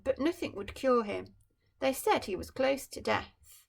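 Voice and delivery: bright storybook reading voice; story narration. A narrator's reading. Parent reading a picture book to a child, storytelling. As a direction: read it like a bedtime picture book.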